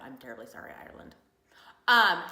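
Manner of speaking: usual speaking voice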